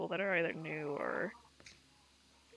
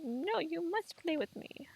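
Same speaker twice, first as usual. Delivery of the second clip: bossy dog voice; 9 semitones higher than usual. Retold or imitated. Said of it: "She's like".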